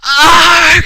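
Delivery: high, loud cry when hit; mock scream of pain. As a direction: scream as if shot, hammed up. Marissa is shot.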